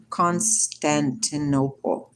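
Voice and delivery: Constantinople said very slowly, every syllable drawn out; slow careful Constantinople. Shown as a demonstration. To her live viewers, slowly spelling out Constantinople.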